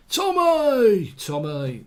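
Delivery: a hammed-up call after Tommy that fades on the repeat; Peaky Blinders shout. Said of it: "I'm normally better than that".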